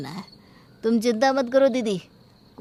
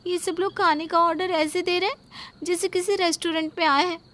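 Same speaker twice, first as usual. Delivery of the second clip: much higher, indignant grumble; indignant complaint; 8 semitones higher than usual. Acted. Daughter-in-law grumbling to her sister-in-law about the guests, higher pitched. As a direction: scoff at the guests' demands.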